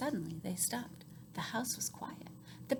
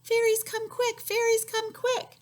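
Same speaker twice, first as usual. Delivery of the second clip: high, loud call; calling shout; 13 semitones higher, 7 dB louder, slower than usual. Shown as a demonstration. Old woman calling the fairies out of the house. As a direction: call out to lure them. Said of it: "help her yell for the fairies".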